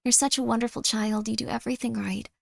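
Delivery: soft, gushing mother voice; hushed maternal praise; loving mom. Acted. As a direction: dote on your child softly and tenderly. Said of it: "Don't you want a mom that's just like".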